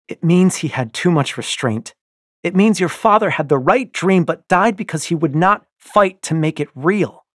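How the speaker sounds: loud, high and cutting; wife lectures her husband sharply, loud. A narrator's reading. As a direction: cut him with each word. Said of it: "she says sharply".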